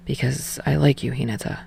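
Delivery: low, soft confession fading near silent; quiet confession. Acted. Tsukishima confessing to Hinata, low soft voice. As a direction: let the name fall away almost breathless.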